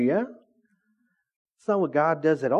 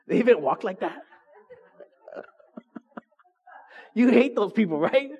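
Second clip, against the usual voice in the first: ordinary talk, nothing marked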